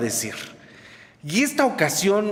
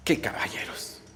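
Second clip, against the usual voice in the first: dry whispered sarcasm, much softer; sarcastic; 7 semitones lower, 10 dB quieter than usual. Real, not acted. Preacher mocks the men who trapped her: sarcastic whisper. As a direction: mutter it with a sneer.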